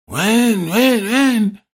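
high imitated engine whine; engine noise. Rider hearing another engine at night, high engine imitation. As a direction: mimic a distant bike revving.

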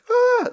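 short, very high, loud panicked scream; mock panic scream. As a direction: shriek like a man in a full panic attack. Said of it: "He's screaming".

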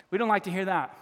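ordinary delivery

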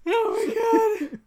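high, slow laughing groan; weak with real laughter; helpless laughter. Real, not acted. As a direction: gasp it out while cracking up. Helpless laughter after the bit.

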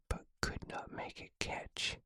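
plain reading voice

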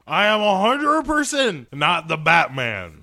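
loud, high, hammed-up declaration; mock hero voice. Billionaire denies being a superhero, loud and hammed-up. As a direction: proclaim it like an overblown superhero.

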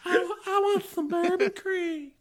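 high, loud, drawn-out Southern belle; hammed-up Southern belle squeal, slowly. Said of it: "I said it like a Southern belle".

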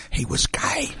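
high conspiratorial whisper. Acted. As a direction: whisper it like a secret. Conspiratorial aside about Tchaikovsky.

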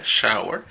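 "shower" repeated, a clear English sh; English word demo. Shown as a demonstration. Teacher repeating the example after translating it, careful demo. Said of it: "que es como ducha".

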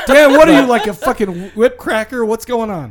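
loud, high laughing jab; laughing tease. Real, not acted. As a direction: crack up while teasing the bandmate. Laughing teasing outburst.

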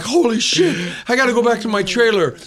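high-pitched mock freak-out; retold inner panic. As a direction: rattle off worries as if panicking inside. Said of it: "freaking out in my mind, pure fear".

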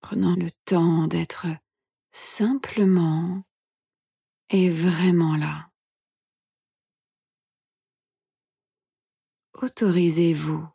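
soft, hushed meditation guide; guided meditation whisper. Acted. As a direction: whisper gently, leaving long pauses.

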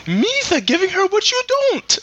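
squeaky Jar Jar Binks taunt. Pretend. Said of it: "redo Jar Jar Binks".

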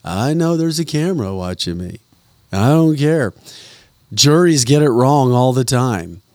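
mock the judge's shrugging arrogance; mocking judge impression. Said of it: "You should do an impression of him".

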